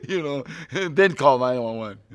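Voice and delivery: loud laughter running into the words; real laughing. Real, not acted. Actor joking about co-stars in a fight, laughing. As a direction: crack up, then talk through it. Cracks up at own joke.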